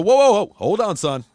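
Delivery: loud, forceful call to back off; acted-out confrontation, loud at the top; retold angry warning. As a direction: start on a high, loud whoa, then threaten. Retold backstage confrontation, warning someone off.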